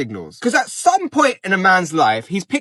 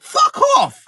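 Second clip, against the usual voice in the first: exasperated yell; 3 dB louder than usual. Real, not acted. Outburst after mock musing.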